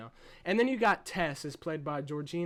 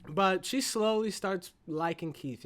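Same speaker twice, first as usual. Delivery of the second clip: ordinary talk, nothing marked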